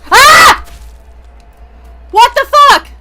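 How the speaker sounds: high furious screaming at the game. Real, not acted. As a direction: scream in panic and rage. Jump scare in game.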